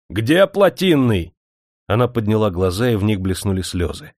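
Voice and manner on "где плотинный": raised-pitch, slow demand; aggressive demand. A narrator's reading. Blustering manager confronting the girl, aggressive demand. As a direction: pounce on someone with a question. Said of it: "он налетел на Дуняшку".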